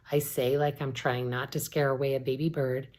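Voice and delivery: ordinary delivery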